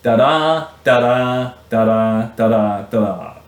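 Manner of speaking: da-DA da-DA, chanting the beat of each pair; iambic da-da rhythm. Shown as a demonstration. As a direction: tap out iambic pentameter as rhythmic da-das. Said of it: "the poem sounds like this".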